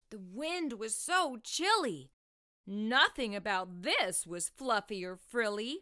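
plain reading voice

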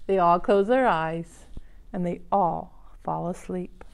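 gentle storybook reading voice; reading to children. Acted. Reads picture book to children.

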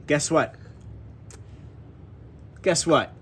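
normal talking voice

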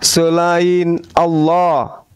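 slow, deliberate word for the convert to repeat; slow recitation lead. Shown as a demonstration. Teacher leading the convert through the shahada, slow and deliberate. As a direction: lay out each syllable slowly for him to echo.

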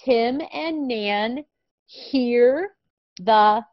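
very slow, clear reading; slow reading aloud. Shown as a demonstration. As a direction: model the sentence word by word. Models the sentence slowly.

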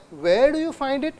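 "Where do you find it" rising to the end; going up. Shown as a demonstration. Lecturer tracing the question's climb for students, going up. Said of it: "I'm going up".

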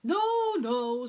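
very high, loud no no; cocky denial. Acted. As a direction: wave away the panic with smug cheer. Prince cuts off sheriff.

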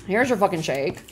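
ordinary delivery

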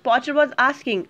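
usual speaking voice

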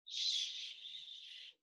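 soft unvoiced shush; whispered shh. Shown as a demonstration. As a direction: hush them gently with a whisper. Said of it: "It means".

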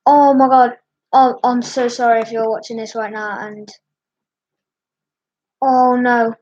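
ordinary delivery

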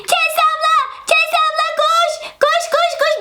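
put-on high, urgent little kid's cry for help; panicked child calling. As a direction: call her over in a panic. Child panics, calls big sister. (telaşla ablasını çağıran küçük çocuk)

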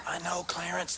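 whispering. Acted. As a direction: whisper back to him. Hushed reply to whisper.

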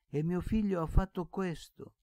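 the blind uncle lamenting his son's deed; anguished repetition. Acted. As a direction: wail it out in anguish. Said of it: "ripeteva zio Pietro".

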